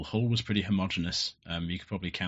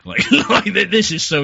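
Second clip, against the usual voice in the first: high, loud laughing words; real laughter spilling over the words; 13 semitones higher, 15 dB louder than usual. Real, not acted. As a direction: crack up mid-sentence.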